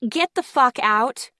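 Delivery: a full furious shout. A narrator's reading. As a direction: yell her out the door. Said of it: "Riley shouted".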